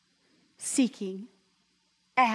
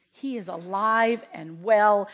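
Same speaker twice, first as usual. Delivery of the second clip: usual speaking voice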